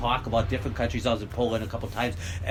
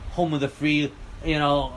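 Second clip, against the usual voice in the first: ordinary talk, nothing marked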